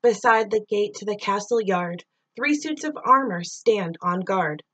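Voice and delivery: ordinary delivery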